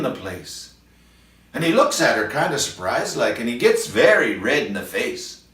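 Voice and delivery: a storyteller reciting a comic poem aloud; ballad recitation. Acted. Vernon performs a poem for shut-in viewers, recitation voice. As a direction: tell the verse with relish to an audience.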